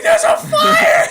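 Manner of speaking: high, drawn-out mock scream; hammed-up panicked shriek; hysterical. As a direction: imitate a hysterical shout at full volume, laughing behind it. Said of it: "Trent was just hysterical".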